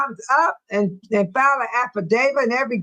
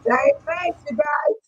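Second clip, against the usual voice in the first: squealing emphasis; 13 semitones higher, slower than usual. Real, not acted. Mocking the unchanged face.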